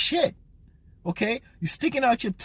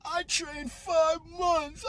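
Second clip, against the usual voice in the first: higher, slow whiny mock of the boxer's words; mocking the crying boxer; 8 semitones higher, 6 dB quieter, much slower than usual. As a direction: parody the sobbing boxer, drawn out. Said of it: "the allusion to his crying is".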